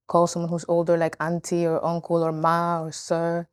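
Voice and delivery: ordinary talk, nothing marked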